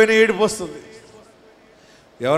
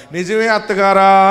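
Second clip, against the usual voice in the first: mock cry; 4 semitones higher, 8 dB louder, slower than usual. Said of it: "అని ఏడిచిందట".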